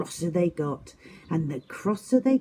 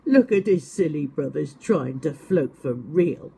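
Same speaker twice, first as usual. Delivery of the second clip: bear voice; 4 dB louder than usual. Acted. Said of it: "laughed the bear".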